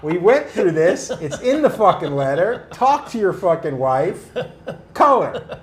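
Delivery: loud, high, emphatic protest; exasperated retold insistence. Client insisting to the tattooer on color, exasperated. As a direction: hammer each demand at the tattooer.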